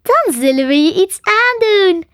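high, loud, gleeful menace; grinning threat. A narrator's reading. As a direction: relish the threat with a grin. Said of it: "grijnsde Sam".

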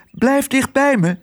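high, insistent warning; worried, high-pitched instruction; urgent warning. A narrator's reading. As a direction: call them back in a high voice. Otje warns mice to stay close.

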